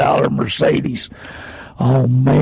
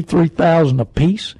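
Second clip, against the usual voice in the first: ordinary delivery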